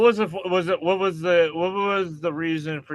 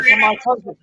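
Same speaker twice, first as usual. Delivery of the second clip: higher, louder mimic of the guest; hint of the woman's pushy voice, higher and louder; quoted hotel guest; 8 semitones higher, 9 dB louder, faster than usual. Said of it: "she said".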